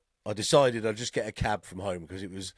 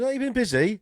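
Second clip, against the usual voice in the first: high, chirpy cab driver question; cabbie small talk; 12 semitones higher, 5 dB louder than usual. Retold or imitated. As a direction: pipe it up like a nosy cabbie. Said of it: "the same thing still comes".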